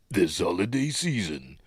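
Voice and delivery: slow, lightly mocking trailer announcer; mock American trailer voice. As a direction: send up the trailer's phrasing, slowly. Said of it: "Eh, dear".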